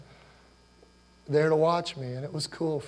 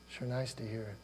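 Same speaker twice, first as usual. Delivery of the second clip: "Sure nice to hear it" repeated much softer, almost to himself; soft echo; 3 semitones lower, 9 dB quieter than usual. Shown as a demonstration. Pastor repeats the line gently to the congregation, soft echo. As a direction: let "nice" fall away quietly. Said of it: "But sure nice to hear it".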